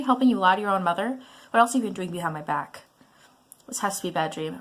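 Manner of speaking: usual speaking voice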